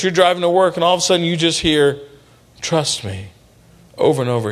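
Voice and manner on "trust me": soft inner voice. Retold or imitated. God's voice heard while driving.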